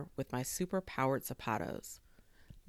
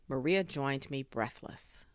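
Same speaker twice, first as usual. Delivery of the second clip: ordinary delivery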